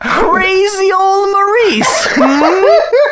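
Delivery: high, slow goofy dad voice; slow and high, on the edge of laughing; mock hip dad. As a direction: ham up an out-of-touch old man. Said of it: "executive impression continues".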